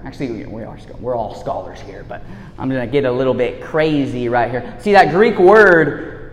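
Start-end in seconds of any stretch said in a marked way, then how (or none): none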